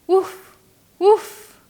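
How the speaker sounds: bouncy cartoon woof for kids; dog bark. Shown as a demonstration. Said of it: "the dog".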